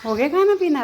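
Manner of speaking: high, loud, swooping question; pet-talk. Real, not acted. Owner checks dogs finished their meal, high sing-song pet-talk.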